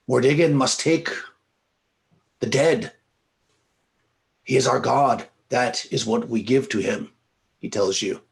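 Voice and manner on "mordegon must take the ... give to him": higher, raised voice of solemn conviction; proclaiming innkeeper. Acted. Innkeeper calling out to the priests over a body, proclaiming. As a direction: proclaim it as doctrine. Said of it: "he's called out for the priests".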